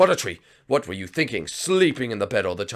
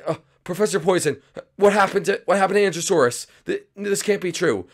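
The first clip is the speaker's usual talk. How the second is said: high, fast panicky sidekick voice; much higher, rushed scared little sidekick; frightened sidekick; 10 semitones higher, 3 dB louder than usual. Acted. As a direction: squeak out your shock at the villain. Captive sidekick pleads with villain.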